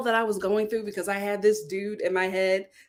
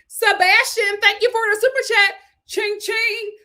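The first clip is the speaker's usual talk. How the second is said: excited shoutout; 10 semitones higher, 7 dB louder than usual. Real, not acted. Super chat shoutout.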